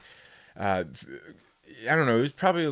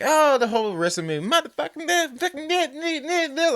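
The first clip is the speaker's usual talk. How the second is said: high squeaky mock rant with a laugh; mock ranting lady; 13 semitones higher than usual. Mocking the woman trashing him, high and squeaky. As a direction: ham up her high-pitched ranting. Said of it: "She's like".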